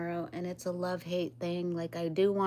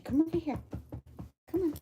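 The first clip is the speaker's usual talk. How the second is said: calling the dog; 10 semitones higher, faster than usual. Real, not acted. Calling her dog Hachi over.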